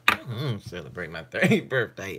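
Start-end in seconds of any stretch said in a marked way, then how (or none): none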